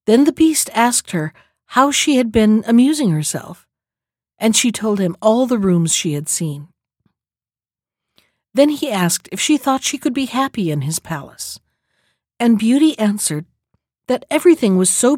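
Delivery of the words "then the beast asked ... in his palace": plain reading voice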